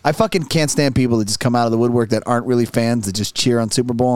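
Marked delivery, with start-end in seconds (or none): none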